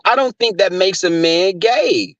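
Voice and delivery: ordinary delivery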